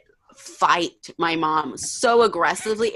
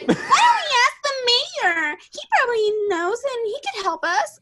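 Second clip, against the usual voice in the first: mocking Dylan. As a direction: squeak it out like a naive do-gooder. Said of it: "was like".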